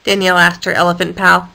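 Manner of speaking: plain reading voice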